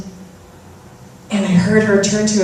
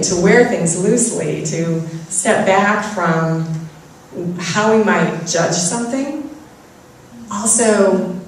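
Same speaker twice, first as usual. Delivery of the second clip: ordinary delivery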